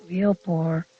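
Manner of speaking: usual speaking voice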